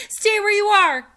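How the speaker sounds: high loud warning shout. A narrator's reading. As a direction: shout her back. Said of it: "Jack shouted".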